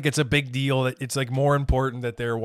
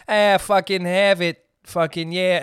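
hammed-up New York accent, louder, higher and slow; mock New York accent, a Boston guy on vacation; lazy New York accent drawl; 5 semitones higher, 4 dB louder than usual. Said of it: "impression that I do of a New York guy".

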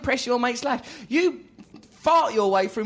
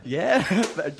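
ordinary delivery

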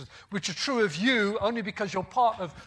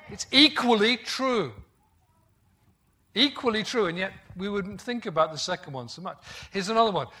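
ordinary delivery

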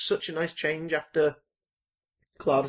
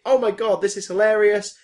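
imitated excitement; 4 semitones higher, 6 dB louder than usual. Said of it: "Comic Relief being more like".

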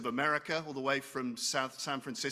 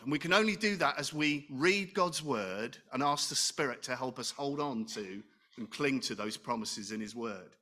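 ordinary talk

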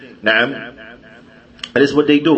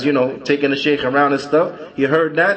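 ordinary talk, nothing marked

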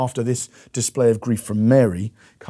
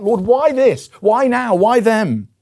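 high, loud, pleading why; anguished questioning; 11 semitones higher, 8 dB louder, slower than usual. Retold or imitated. Grieving person crying out to God, high and loud. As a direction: cry out the questions in grief.

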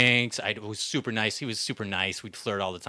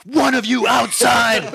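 higher, louder, slow shout with a laugh; shouted correction; 12 semitones higher, 8 dB louder, slower than usual. Retold or imitated. Barista correcting himself at two fighters, slow shout. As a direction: bark it out word by word. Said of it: "I'm like".

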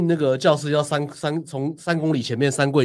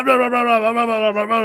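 mock blah-blah babble, high and fast; 8 semitones higher, 3 dB louder, faster than usual. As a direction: jeer at a rambling explanation, pitched up. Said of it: "我就说".

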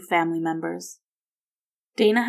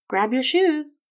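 high, cheerful invitation; rally a grandson brightly for an outing; happily; 8 semitones higher, 4 dB louder, slower than usual. A narrator's reading. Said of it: "Margaret said happily".

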